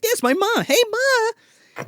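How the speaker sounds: very high put-on kid voice; mock innocent child; teen greeting mom. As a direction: call to Ma in a silly high voice. Acting teens greeting their mother.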